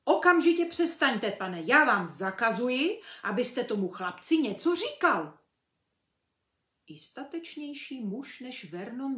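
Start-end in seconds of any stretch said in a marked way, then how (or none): 0.0-5.4 loud bossy forbidding; commanding. A narrator's reading. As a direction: forbid it like a tyrant uncle. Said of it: "poroučel".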